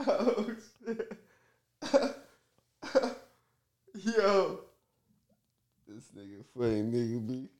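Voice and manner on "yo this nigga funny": ordinary delivery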